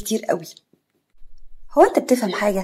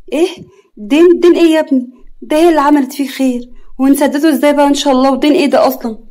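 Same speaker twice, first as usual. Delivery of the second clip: high, loud disbelieving questions; shocked protest; 6 semitones higher, 6 dB louder than usual. Acted. Mother protests loudly at the suitor's words, shocked. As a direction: fire questions in outraged disbelief.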